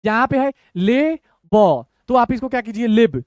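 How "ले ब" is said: slow, each letter held apart; broken into letters. Shown as a demonstration. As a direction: show how to break the word up. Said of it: "अब तोड़ना कैसे ये देखिए".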